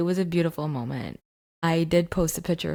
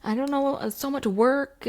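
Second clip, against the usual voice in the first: much higher, panicked husband over the phone; mock yelling husband; 9 semitones higher than usual. Husband on the phone panicking about the cost, high mock panic. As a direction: squeal like a stressed-out husband. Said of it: "my husband's on the phone yelling".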